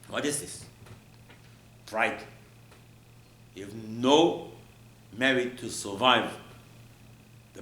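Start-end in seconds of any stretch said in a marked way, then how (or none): none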